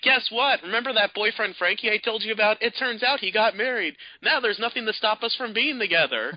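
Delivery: high, giddy and pleased with herself; gleeful girlfriend. Retold or imitated. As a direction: announce it like it's great news.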